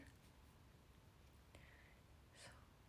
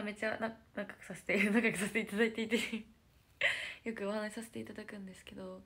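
ordinary talk

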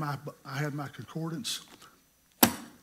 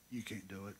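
quiet divine voice; 7 semitones lower, 16 dB quieter than usual. Retold or imitated. God speaking to him.

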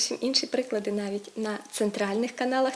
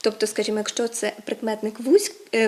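usual speaking voice

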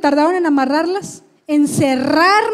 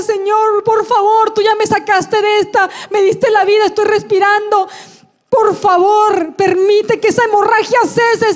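raised, urgent plea to God; oración suplicante; 4 semitones higher than usual. Retold or imitated. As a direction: pray desperately for the bleeding to stop. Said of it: "empiezo a orar". (clamando a Dios con urgencia)

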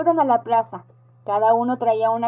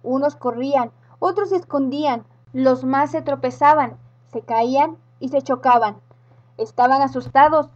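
plain reading voice

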